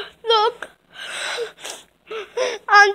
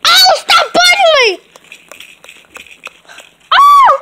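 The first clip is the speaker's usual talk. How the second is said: high, loud laughing yelp; bitten doll; 7 semitones higher, 4 dB louder than usual. Pretend. Doll yells at the biter to stop, high and loud. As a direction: yelp like you are being bitten.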